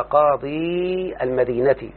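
قاضي stretched on a long "ee" before المدينة; restored ya. Shown as a demonstration. Teacher showing the ya returns in annexation, restored ya. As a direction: hold the final vowel of قاضي, slowly. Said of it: "رد إليه إيه؟".

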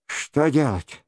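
usual speaking voice

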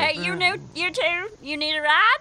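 loud, high-pitched trucker call. Acted. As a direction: holler from the cab like an old trucker. Trucker calls out to them.

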